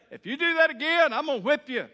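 put-on loud, high shouted warning to a child; angry parent threat. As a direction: yell the threat like a fed-up parent. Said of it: "And then we say".

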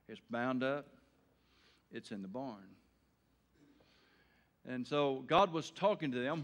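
normal talking voice

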